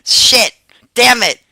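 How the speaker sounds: high, loud squawky cursing; mock parrot curses.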